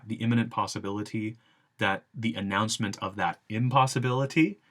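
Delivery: ordinary delivery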